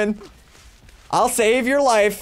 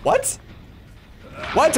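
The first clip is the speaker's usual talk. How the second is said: shocked mom; 5 semitones higher, 3 dB quieter than usual. Pretend.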